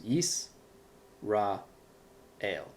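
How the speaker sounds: Yis, Ra, El as three separate syllables with long pauses. Shown as a demonstration. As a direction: break the word into Yis... Ra... El. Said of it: "is actually three syllables".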